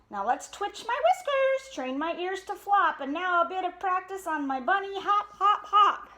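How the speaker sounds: high, bouncy bear voice; showing off his bunny hop; playful Papa Bear. Acted.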